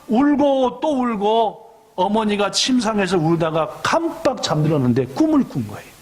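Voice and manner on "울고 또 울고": slow, high, mournful drawn-out words; slow mourning over a wayward son; slow lament. Acted. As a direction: stretch each word like a lament. Mother weeps again and again.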